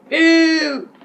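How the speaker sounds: hammed-up booing; mock jeer. [name] shrugs off teacher's apology, loud mock boo.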